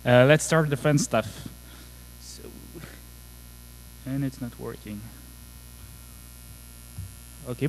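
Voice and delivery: normal talking voice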